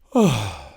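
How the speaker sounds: loud relieved out-breath; long release exhale. Shown as a demonstration. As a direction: let the smoke and tension go in one breath. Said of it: "permission to relax and exhale".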